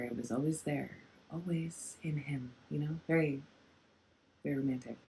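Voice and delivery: hushed, tender, almost to yourself; soft tender murmur. Real, not acted. Tarot reader musing tenderly to her viewers, soft and hushed. Tender reading about the camel.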